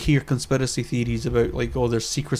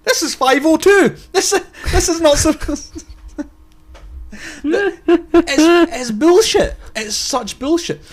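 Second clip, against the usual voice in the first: much higher, loud disbelieving outburst; incredulous rant; 12 semitones higher, 3 dB louder than usual. Retold or imitated. Viewer watching Parliament, outraged incredulous rant. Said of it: "you're watching this going".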